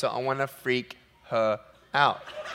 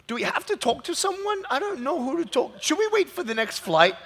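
higher, anxious fretting voice; worried passenger; 12 semitones higher than usual. Retold or imitated. Passenger asking who to talk to, anxious. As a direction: fret like a confused traveller. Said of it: "I'm like".

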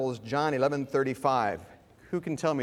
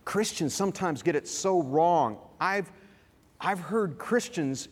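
ordinary talk

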